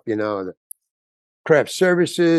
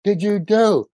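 retold angry outburst; 7 semitones higher than usual. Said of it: "what I had just done with the shout".

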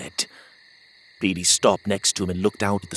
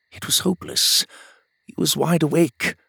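usual speaking voice

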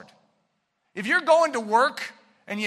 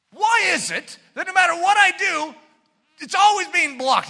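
complaining sluggard; 8 semitones higher, 5 dB louder than usual. Acted. Preacher voices the sluggard's complaint.

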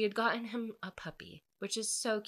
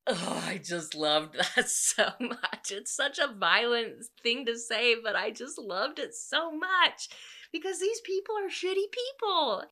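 rising giddy squeal, higher and louder, with a laugh; gushing delight; 6 semitones higher, 6 dB louder than usual. Real, not acted. Reader gushing to viewers about the hero's threat, giddy squealing. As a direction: swoon over the line like a thrilled fan. Gushing over the book's defense.

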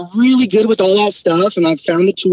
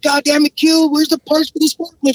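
exasperated boss voice raised higher; mock-angry demand for the missing parts; angry foreman; 8 semitones higher than usual.

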